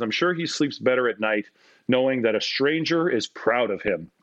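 ordinary talk, nothing marked

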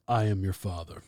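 lightly voiced deep Vader; corrected Vader line. Shown as a demonstration. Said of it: "He just says".

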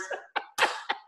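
hearty laugh at own joke. Real, not acted. Guest joking to the host about Texans and horses, laughing. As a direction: crack up at your own punchline.

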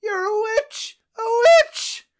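very high, put-on old-world voice rising to a cry; accusing peasant. Man from the past accuses the traveler of witchcraft, very high. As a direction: point and shriek it out. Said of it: "I'm the one that has to do the accent".

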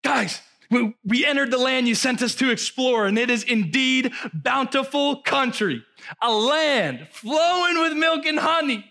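high, eager returning scouts; spies' report. Retold or imitated. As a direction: sell the land like excited scouts. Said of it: "this was their report to Moses".

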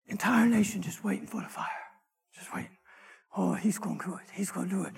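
very soft, half-whispered suspense; hushed anticipation. Acted. Entire nation waiting for the fire, hushed. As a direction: hush it like an awed onlooker.

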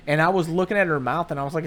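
ordinary talk, nothing marked

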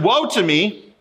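preacher voices Isaiah before God, a touch of lament; Isaiah's lament. Retold or imitated. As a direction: lightly voice a prophet's despair. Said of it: "He said".

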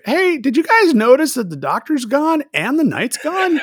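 a lightly voiced Sulu asking the crew; high mock Sulu. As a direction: pitch it up as a confused crewman. Voicing Sulu in retelling.

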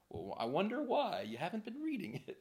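soft, sing-song pointed reply; quiet, knowing "I wonder why"; mock wondering. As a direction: feign puzzlement while gently calling them out. God's pointed reply to the excuse.

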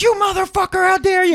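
high, loud mock rage; hammed-up furious yell at dad; playing his younger self flipping out; retold outburst. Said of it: "flipped out".

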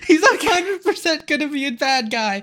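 high, emphatic, breaking into laughter; laughing insistence. Real, not acted. Podcaster arguing the villain is obvious, laughingly insistent. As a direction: insist it's blindingly obvious.

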